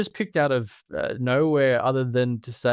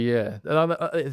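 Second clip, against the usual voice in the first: ordinary talk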